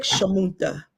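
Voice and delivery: "shamunta" said softly, stress on "mun"; Arabic word. Shown as a demonstration. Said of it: "bitch in Arabic".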